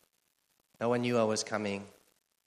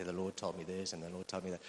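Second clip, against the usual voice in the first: mock spiritual claim; 6 semitones lower, 10 dB quieter than usual. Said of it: "kind of simplify it a bit and say".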